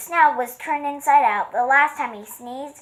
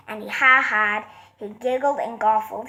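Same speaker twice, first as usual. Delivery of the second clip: plain reading voice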